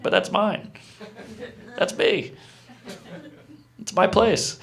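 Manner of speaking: soft, high-pitched mock pleading; small, high voice of someone turned away; plaintive protest. Pleading with phone bouncer.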